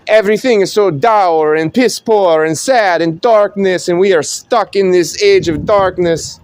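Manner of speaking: a clergyman lamenting darkness in a mock Italian accent; mock Italian preacher.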